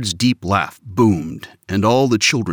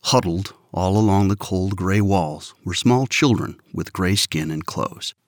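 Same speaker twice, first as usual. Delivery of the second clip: usual speaking voice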